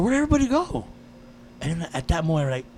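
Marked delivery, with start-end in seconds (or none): 0.0-0.8 high, rising puzzlement; retold high-voiced confusion; bewildered question. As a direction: ask it pitched way up, at a loss. Dream self asks uncle, bewildered.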